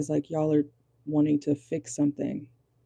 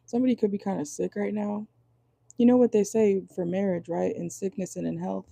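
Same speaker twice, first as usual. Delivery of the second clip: usual speaking voice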